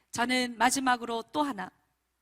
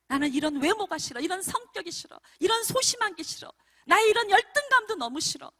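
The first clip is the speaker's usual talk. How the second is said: higher, fretful acted complaint; voiced self-hating teen; 7 semitones higher, 5 dB louder than usual. Teenager hating their own looks and character, acted self-loathing. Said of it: "자기를 미워하는지 몰라요".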